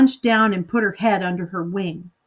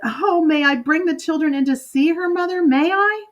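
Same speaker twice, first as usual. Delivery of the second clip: put-on much higher, eager child voice climbing; a delighted daughter begging; rising, excited little girl; excited pleading girl; 7 semitones higher than usual. Girl begs to show friends.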